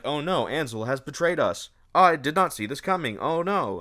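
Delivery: hammed-up "oh no" of betrayal; mock dismay. Retelling the heroine discovering Ansel has betrayed her, mock dismay.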